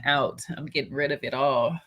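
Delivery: ordinary talk, nothing marked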